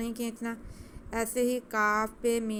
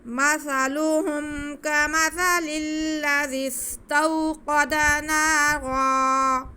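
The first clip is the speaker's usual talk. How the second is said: slow, high, loud melodic tajweed chant; measured tajweed recitation, each word drawn out; Quran recitation; 6 semitones higher, 8 dB louder, much slower than usual. Shown as a demonstration. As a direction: recite each phrase stretched and ringing like a qari. Opening recitation of the verse.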